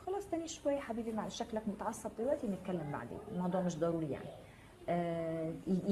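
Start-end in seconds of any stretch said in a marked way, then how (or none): 0.1-4.3 soothing partner. Acted. Partner putting off a talk with an angry loved one, soothing. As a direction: gently back off to calm an angry partner.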